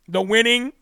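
usual speaking voice

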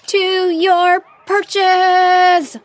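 very loud, high, drawn-out bellow; bellowed command. Acted. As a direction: call it across the whole clearing.

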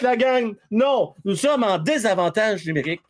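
usual speaking voice

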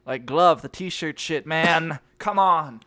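normal talking voice

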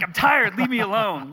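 loud, high mock complaint; smiling retort. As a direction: snap back jokingly, with a smile. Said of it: "Like, with a smile".

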